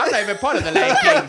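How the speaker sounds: high laughing outburst. Real, not acted. Telling the podcast crew he wasn't even a member, laughing loud. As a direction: laughs as he blurts it out.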